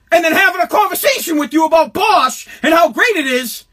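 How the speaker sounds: ranting shout. Real, not acted. Comedian ranting to the audience about freeloaders, shouting. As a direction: yell it out in outrage.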